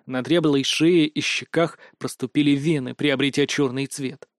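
plain reading voice

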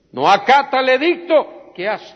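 high, loud denunciation; accusing outcry. Acted. Accusers to the king against Daniel, loud and high. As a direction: cry out the accusation.

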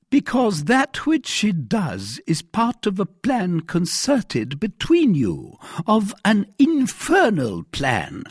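high, slow, emphatic accusation; accusing commissary. A narrator's reading. Commissary accusing the mercer of plotting, stern and heated. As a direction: spell out the charge, slow and high.

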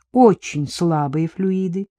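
ordinary delivery, nothing marked

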